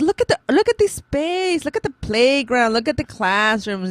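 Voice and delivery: put-on high, sing-out excitement for a child; acted parental cheerleading; retold coaxing enthusiasm. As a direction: talk up the sights to win over a kid. Retells coaxing a reluctant child.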